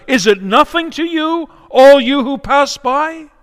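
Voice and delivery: high, loud anguished outcry; crying out. Acted. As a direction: hurl it at the passers-by at the top of your lungs. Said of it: "at the top of her lungs, she cries out".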